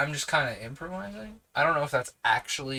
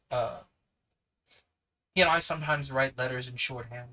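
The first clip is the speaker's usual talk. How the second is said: usual speaking voice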